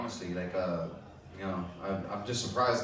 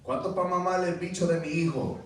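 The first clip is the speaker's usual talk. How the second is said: retold scream; 6 semitones higher than usual. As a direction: imitate dad hollering at a passerby. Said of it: "just screams at her and goes".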